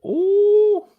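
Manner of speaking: a very high, loud squeal of ooh. Real, not acted. Reacting to Caprice's quote, a high squealed ooh.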